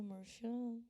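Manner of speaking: fading away. Shown as a demonstration. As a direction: let the voice wilt away. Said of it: "você vai murchando".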